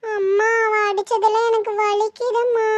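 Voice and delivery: put-on slow whine of a hurt little girl; drawn-out, weepy child voice; tearful child.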